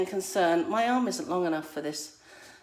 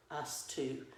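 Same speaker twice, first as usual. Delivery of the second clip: normal talking voice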